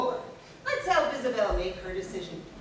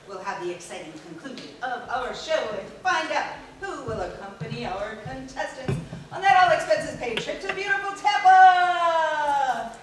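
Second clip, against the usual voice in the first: booming prize announcer; 5 dB louder than usual. Acted. Host teasing prize announcement.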